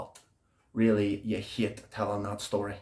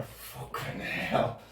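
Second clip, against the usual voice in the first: whispered oath; 8 dB quieter, much slower than usual. Acted. Overwhelmed by anticipation.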